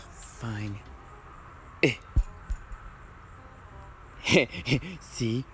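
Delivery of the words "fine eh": usual speaking voice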